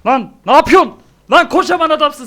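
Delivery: loud, high outburst; high-pitched yell of alarm; yelling at the screen. Real, not acted. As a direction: shout at the chasing brute. Yelling at game enemy.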